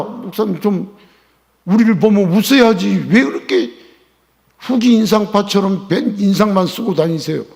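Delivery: loud, high mock complaint to the pastor; complaining congregant. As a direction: scold the pastor playfully. (목사님께 투덜대는 교인)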